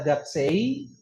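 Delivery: gaksei with a distinct final i, "gak-se-i"; wrong i ending. Shown as a demonstration. Teacher showing a wrong reading, audible final i. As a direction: sound the i at the end of gaksei. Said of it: "ada I-nya juga salah".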